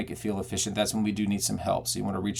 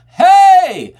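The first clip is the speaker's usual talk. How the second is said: high, loud, sustained call; full-voiced holler; belted yell; 15 dB louder than usual. Shown as a demonstration. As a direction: yell it out with a belt. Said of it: "a need to yell".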